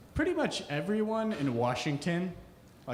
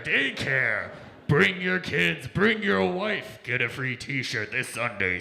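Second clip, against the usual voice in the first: loud booming radio promo, slowing on the pitch lines; monster truck ad; 6 dB louder, slower than usual. Retold or imitated. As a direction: yell every Sunday like a rally commercial. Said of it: "they use that style of commercial for everything".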